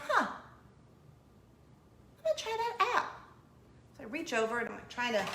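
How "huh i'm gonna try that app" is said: mock sudden idea. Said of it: "So I'm like".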